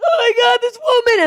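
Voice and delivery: loud, very high mock wail; bawling. Crying child running to tell mom, bawling. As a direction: imitate a kid bawling hysterically. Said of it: "I'm bawling my eyes out".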